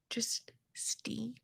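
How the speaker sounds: whispered in disbelief; host whispering her disbelief to viewers; whispered aside. Real, not acted. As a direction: breathe it out as a secret aside. Disbelief aside on steamed shrimp.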